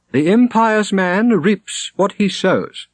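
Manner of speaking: slow, high, solemn declamation; a pious prior's grave sentence; declaiming prior. A narrator's reading. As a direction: proclaim it from the pulpit, slowly. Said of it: "declaimed Robert".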